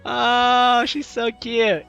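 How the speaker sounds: ordinary talk, nothing marked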